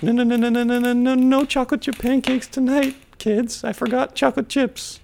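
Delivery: high falsetto grandma voice; mock grandma. Grandma refusing the kids pancakes, mock falsetto. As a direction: dither in a put-on high old-lady voice. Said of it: "Grandma's like".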